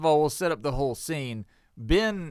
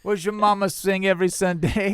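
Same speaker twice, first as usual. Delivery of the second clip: put-on country accent; 5 semitones higher, 5 dB louder than usual. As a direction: lay on a thick Southern country accent. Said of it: "put it on your most country accent".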